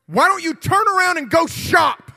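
high, loud shouted comeback; full-voice mock-tough command; retold confrontation. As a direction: re-enact yelling down a bully. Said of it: "I said".

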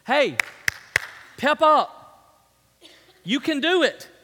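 high, loud rallying shouts; punchy motivational yell, phrase by phrase; shouted pep talk. Acted. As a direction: bark encouragement like a hype man. Mock pep talk to congregation.

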